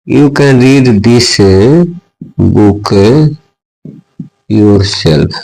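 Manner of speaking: very slow, word by word dictation. Shown as a demonstration. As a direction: spell it out slowly so students can write. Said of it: "teacher dictating English example". (धीमे-धीमे लिखवाना)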